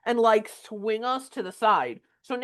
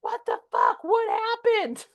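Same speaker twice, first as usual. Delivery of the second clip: panicked; 14 semitones higher than usual. Retold or imitated. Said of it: "And she's like".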